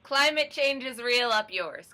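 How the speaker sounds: loud, high defiant toast; rowdy toast. Real, not acted. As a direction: raise a glass and shout it. Mock toast with drinks.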